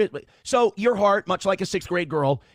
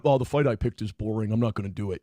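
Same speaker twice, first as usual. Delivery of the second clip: lower, sulky mock of a quitter; mocking quote; 9 semitones lower than usual. Mocking a rival who quits his own fight, lower and sulky. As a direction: sneer at him in a lower, dragging voice. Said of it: "You're sitting there going".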